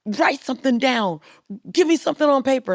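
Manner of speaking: panicked orders to an assistant, rushing; mock-frantic boss; freaking out. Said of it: "I freak out".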